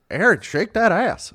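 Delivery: hollering at his son-in-law to shake it; mock dad holler. Dad hollering at Aaron shaking the cup, drawn-out mock call. Dad calls out during drinking.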